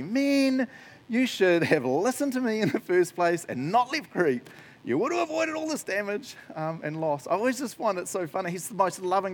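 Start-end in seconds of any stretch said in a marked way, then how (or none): 0.0-7.3 high, smug scolding; arrogantly. Pretend. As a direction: lecture them like you were right all along. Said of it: "kind of arrogantly".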